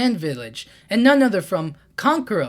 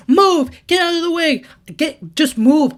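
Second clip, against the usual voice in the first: much higher, much louder yell to clear the way; distant shout; 10 semitones higher, 8 dB louder than usual. Retold or imitated. Sarada shoving past people, yelling much higher and louder. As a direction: bellow at the crowd from afar. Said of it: "we hear a voice in the distance".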